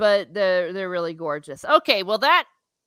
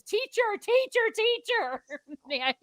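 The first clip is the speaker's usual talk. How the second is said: pleading schoolchild; 12 semitones higher, 3 dB quieter than usual. Shown as a demonstration. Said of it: "Which is".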